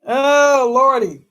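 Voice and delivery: normal talking voice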